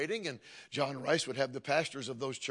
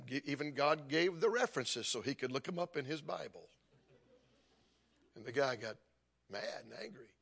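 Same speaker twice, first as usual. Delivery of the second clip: usual speaking voice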